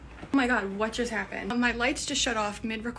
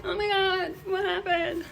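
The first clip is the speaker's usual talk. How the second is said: surprised; 8 semitones higher than usual. Retold or imitated. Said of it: "you'd wake up and be like".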